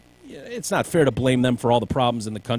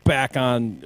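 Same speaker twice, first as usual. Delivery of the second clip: ordinary talk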